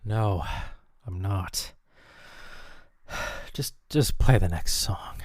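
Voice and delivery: low, soft, drained of energy; worn-out and defeated. Acted. Host admitting to the engineer she's not okay, defeated. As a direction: sigh it out and give up. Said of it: "You okay over there, [name]?".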